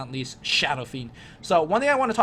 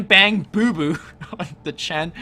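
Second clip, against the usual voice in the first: giggling through a silly name; laughing; 5 dB louder than usual. Real, not acted. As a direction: laugh while saying the name. Mocking team name while laughing.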